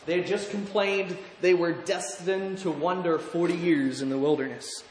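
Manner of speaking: normal talking voice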